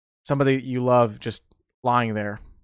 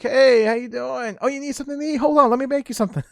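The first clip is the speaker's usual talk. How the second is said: high, doting grandmother offering food; grandma voice; 11 semitones higher than usual. Retold or imitated. Grandma greeting her visiting grandkid in a high doting voice. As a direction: fuss over the guest like a sweet grandma. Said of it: "she'd be like".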